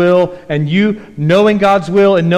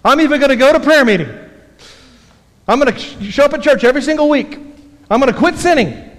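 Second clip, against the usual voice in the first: higher and fast, mock eager resolve; mock a new convert's big promises; 7 semitones higher, faster than usual. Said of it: "they go".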